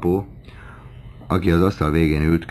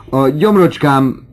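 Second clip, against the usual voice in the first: loud, high-pitched exclamation; kiáltás; 9 semitones higher, 7 dB louder than usual. A narrator's reading. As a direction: cry out the complaint for all to hear. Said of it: "kiáltotta Horace Bianchon". (hangos, magas hangú felkiáltás)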